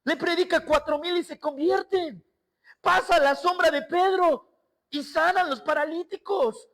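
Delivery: high, loud preaching at full force; fervent preaching. Acted. Pastor preaching to the church at the climax, full force. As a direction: build the congregation up with fire.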